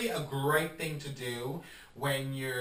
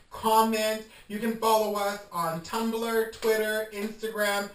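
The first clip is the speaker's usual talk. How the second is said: louder, bright sign-off pitch; enthusiastic outro; 5 semitones higher, 6 dB louder than usual. Acted. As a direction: wrap up the video like a cheerful host. Channel outro call to subscribe.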